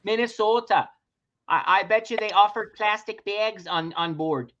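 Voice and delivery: drawn-out mock Minnesota accent. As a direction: tease with long Minnesotan vowels.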